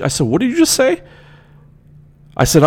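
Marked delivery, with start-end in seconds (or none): 0.3-1.0 indignant retort. Retold or imitated. Retorting to the insult.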